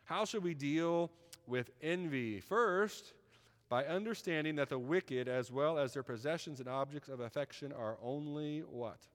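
loud, slow preaching point; preacher pressing the congregation, loud and slow; emphatic preaching. Acted. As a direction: drive the point home from the pulpit.

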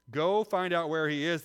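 loud, high kingly command; commanding order. A narrator's reading. King ordering his men, loud and commanding. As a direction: bark it out as an order. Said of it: "the king ordered".

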